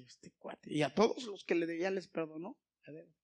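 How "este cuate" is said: breathy under-the-breath remark; a stunned whisper to himself; whispered aside. Retold or imitated. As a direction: mutter it to yourself in disbelief.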